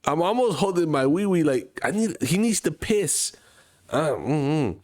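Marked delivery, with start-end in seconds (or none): 1.8-3.3 urgent plea. Retold or imitated. Desperate dad asking for restroom.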